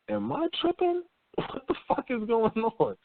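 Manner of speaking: high, incredulous squeak with a laugh; baffled disbelief. Retold or imitated. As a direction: sound completely thrown by it. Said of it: "and you're like".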